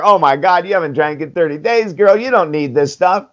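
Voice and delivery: a hint of a gushing best friend egging her on; coaxing like a cheerful voice in her head; tempting pink cloud voice. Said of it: "the pink cloud is telling her".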